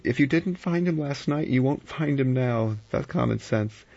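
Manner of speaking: high, pressing argument; urgent. A narrator's reading. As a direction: argue insistently, pitched right up. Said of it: "Vera said urgently".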